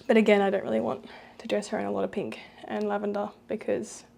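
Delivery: usual speaking voice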